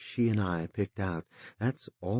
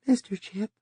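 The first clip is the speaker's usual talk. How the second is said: high, anxious and rushed; flustered, worried voice; agitated; 14 semitones higher, 4 dB louder than usual. A narrator's reading. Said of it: "said agitatedly".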